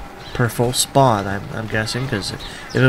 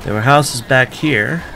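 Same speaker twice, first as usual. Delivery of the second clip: talking through hiccups; holding back hiccups. Real, not acted. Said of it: "I have the hiccups all of a sudden".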